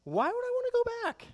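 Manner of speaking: mock shocked falsetto; squeaky high incredulous. Preacher voicing apostles, incredulous high squeak. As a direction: leap up high in disbelief. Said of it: "they understood".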